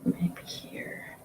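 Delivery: breathy whisper to herself. Real, not acted. Streamer musing over where to dab paint: soft whisper. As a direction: whisper it as if thinking aloud.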